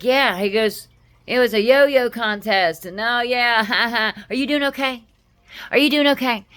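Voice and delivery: needling coworker. Retold or imitated. Coworker needling her about her dead cat, high and mocking. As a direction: play the coworker poking at her grief. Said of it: "he's like".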